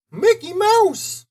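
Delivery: high, slow squeaky mouse; Mickey Mouse voice. Acted. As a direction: chipper cartoon mouse. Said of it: "the first figure said".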